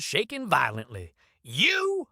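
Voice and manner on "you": high shrieking cry. Acted. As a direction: shriek it out in shock. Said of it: "Beaumont shrieked".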